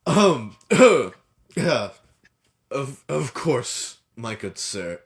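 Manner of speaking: high, loud gasping reply; winded host. Acted. Host, knocked over by the dwarf, answers winded, high. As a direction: catch your breath after being knocked over.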